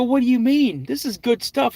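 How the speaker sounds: high, defensive retort to the brother; retold whiny objection; indignant protest. Arguing with brother.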